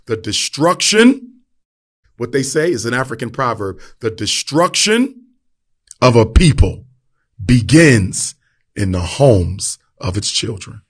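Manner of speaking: slow, swelling preacher cadence with loud bursts; rising to full preaching force on people. Acted. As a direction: drive the proverb home from the pulpit. Delivers a proverb, preacher-style.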